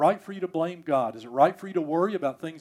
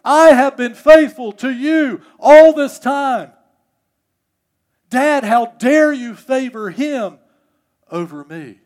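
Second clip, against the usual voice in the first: slow, loud, high-pitched indignant shout; furious displeasure; 10 semitones higher, 17 dB louder, slower than usual. Acted. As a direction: roar the grievance out word by word. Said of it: "he voices his displeasure".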